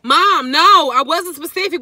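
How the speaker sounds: high, loud whiny protest; loud high daughter voice; daughter's loud admission. Retold or imitated. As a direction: blurt it out like a frustrated teen. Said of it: "retold daughter answer".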